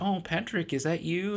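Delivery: high, sing-song imitation of a chirpy lady; surprised woman greeting. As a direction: lift the pitch high to mimic a cheerful acquaintance. Woman greets Patrick in story.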